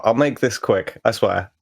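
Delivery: usual speaking voice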